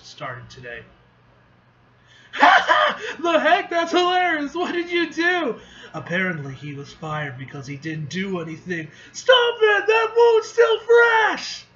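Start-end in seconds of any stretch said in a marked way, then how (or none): 2.3-5.6 high, loud, laughing delight; burst of laughter. Acted. 9.1-11.7 high, loud, slowed cry of protest; pained shout. Acted.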